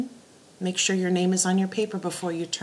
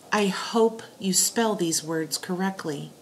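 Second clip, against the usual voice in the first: whispering. Shown as a demonstration. Whispered example sentence.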